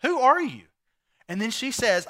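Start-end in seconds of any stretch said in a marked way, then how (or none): 0.0-0.6 mock-startled, high and loud. Boaz waking to a stranger, startled and louder. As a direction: jolt awake and demand it. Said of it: "And so he is startled".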